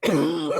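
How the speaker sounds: loud cough from choking. Real, not acted. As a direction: cough cutting off the word. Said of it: "j'ai avalé de travers".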